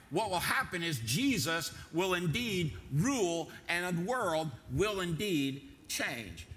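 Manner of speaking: each word set apart by long pauses, rising on wants; slow dramatic stress. Acted. Preacher urging his congregation, slow and pause-heavy. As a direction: preach it slowly, word by word, letting each land. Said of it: "Watch this, folks. Listen".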